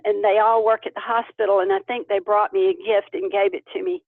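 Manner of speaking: ordinary talk, nothing marked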